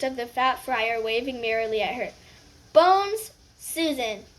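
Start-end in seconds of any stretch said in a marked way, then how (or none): 2.7-4.2 calling the name out across the hall; roll-call voice. Acted. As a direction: announce it loudly to the hall. Hat sorting, names called out.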